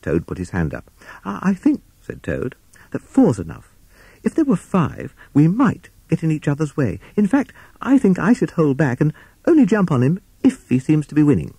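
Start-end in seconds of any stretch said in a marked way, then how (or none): 1.2-1.8 high, halting toad voice; timid Toad. Acted. 2.9-11.5 high-pitched nervous toad; timid Toad making excuses. Acted.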